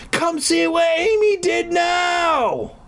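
high, drawn-out angry screech; hammed-up high shout; acted shouting. A narrator's reading. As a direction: screech it slowly like a tattling sister. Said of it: "Sarah was shouting".

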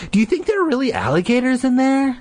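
high, quick worried question; nervous. Acted. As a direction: ask it anxiously, fast.

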